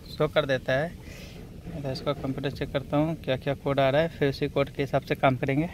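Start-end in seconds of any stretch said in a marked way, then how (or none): none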